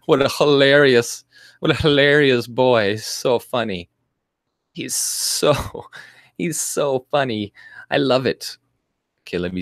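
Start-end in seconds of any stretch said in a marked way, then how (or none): 0.1-8.2 mocking gleeful fans. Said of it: "and were like".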